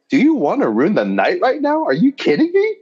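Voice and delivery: high-pitched, incredulous protest; mock outrage. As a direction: shoot way up in exaggerated disbelief. Acted retort to racism question.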